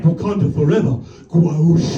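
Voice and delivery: hint of a low heroic catchphrase voice. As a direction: imitate a toy superhero's battle cry, then its sound effect.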